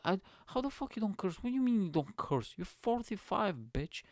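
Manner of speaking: high-pitched mock disbelief; incredulous retort. Curser challenging a forty-five-year-old, high incredulous voice. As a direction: throw it back in a high, baffled voice.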